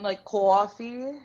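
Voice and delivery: "coffee" said with a long open aw, New York style. Shown as a demonstration. Teacher showing a learner New York "coffee", open aw vowel. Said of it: "They say coffee".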